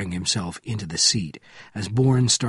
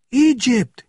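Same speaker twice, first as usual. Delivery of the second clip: very high, loud cry; scream; 14 semitones higher, 7 dB louder than usual. A narrator's reading. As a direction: scream out the name in panic. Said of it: "he screamed".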